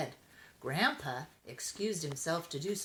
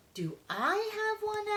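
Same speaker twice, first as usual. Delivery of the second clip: high, curious little kitten voice; eager kitten question. A narrator's reading. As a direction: ask like an eager small child. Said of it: "asked Andy".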